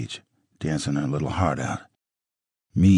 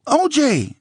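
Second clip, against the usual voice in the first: high, loud yell of the name; hollering; 4 dB louder than usual. Acted. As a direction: call him off sharply. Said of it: "Nicole hollered".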